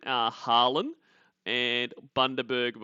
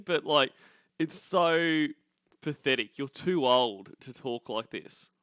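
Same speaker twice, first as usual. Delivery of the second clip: ordinary delivery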